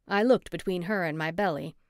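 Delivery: usual speaking voice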